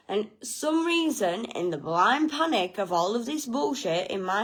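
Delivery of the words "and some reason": ordinary delivery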